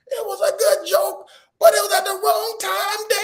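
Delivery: put-on very high, loud crying-kid wail; bawling daughter. Daughter busts out at dad over the prank, mock bawling. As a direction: imitate a child bursting into sobs at dad. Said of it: "Then she busts out".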